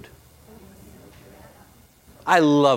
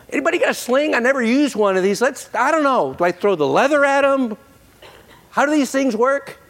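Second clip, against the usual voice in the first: clueless David; 11 semitones higher, 4 dB louder than usual. Pretend. David facing Goliath, clueless.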